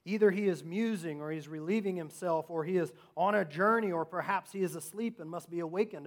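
loud, lightly mocking taunt. Preacher reads Elijah taunting Baal's prophets, mocking and loud. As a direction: call it out loudly, taunting. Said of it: "Elijah mocked them".

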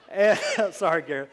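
loud, high words broken by laughter; laughing apology. Real, not acted. Preacher to Gareth after singling him out, laughing. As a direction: apologise through a real laugh.

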